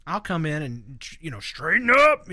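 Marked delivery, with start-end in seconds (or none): 1.4-2.3 stern dad order, louder; daddy voice. Retold or imitated. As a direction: bark it like a strict father. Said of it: "just throw the daddy voice down".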